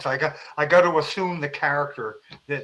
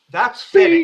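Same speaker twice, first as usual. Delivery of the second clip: emphatic; 13 semitones higher, 10 dB louder than usual. Real, not acted.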